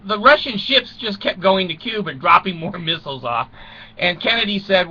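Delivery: usual speaking voice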